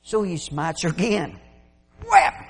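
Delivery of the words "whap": sharp loud high smack; whap sound effect. Retold or imitated. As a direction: slap the sound out. Stick hitting the donkey.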